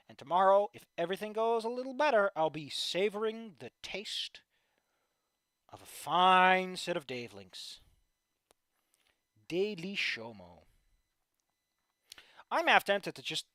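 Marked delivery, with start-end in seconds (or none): 2.4-10.6 savoring. Real, not acted. Relishing tomorrow's links.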